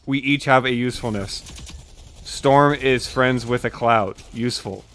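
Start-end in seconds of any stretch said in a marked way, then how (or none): none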